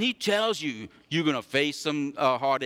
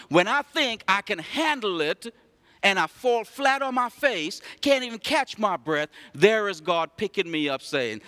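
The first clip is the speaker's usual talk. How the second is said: raised, ringing pulpit voice; full-force preaching; 6 semitones higher, 4 dB louder than usual. Acted. Pastor preaching to the church, raised and ringing. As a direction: preach it out to the congregation.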